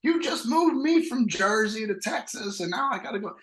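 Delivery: hammed-up furious wife yelling at her husband; mock outraged wife. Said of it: "And she's like".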